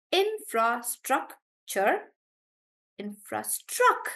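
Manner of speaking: infrastructure slowly, STRUC stressed. Shown as a demonstration. Teacher breaking down infrastructure for students, stress on struc. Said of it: "on struck".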